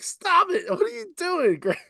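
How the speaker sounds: high, squirming mock protest; mock embarrassed protest. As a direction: whine like a mortified teen. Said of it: "I'm like".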